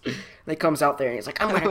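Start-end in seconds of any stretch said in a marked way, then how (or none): 1.4-1.7 higher, louder mock-angry threat; hammed-up angry coworker; playful fake fury. Tucker threatens after being hit.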